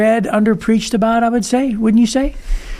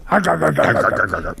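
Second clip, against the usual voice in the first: Mister Krabs arr; 3 semitones lower, faster than usual. Retold or imitated. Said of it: "he's the one that always goes".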